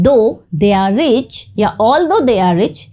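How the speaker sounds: very slow, each word spelled out; slow example reading. Shown as a demonstration. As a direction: dictate both versions slowly. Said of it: "teacher reads both versions". (बहुत धीरे, शब्द-शब्द करके)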